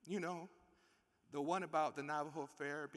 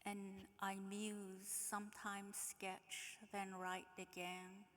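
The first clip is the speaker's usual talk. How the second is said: higher, softer, slow dreamy reading; soft poem reading; 5 semitones higher, 8 dB quieter, slower than usual. Acted. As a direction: let it drift, wistful and quiet. Reads a letter aloud.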